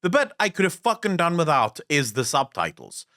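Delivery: normal talking voice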